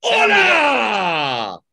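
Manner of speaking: long, loud falling luchador cry; shouted greeting. Pretend. Luchador greeting the whole show, drawn-out shout. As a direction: bellow it out as a showy entrance. Wrestler makes a shouted entrance.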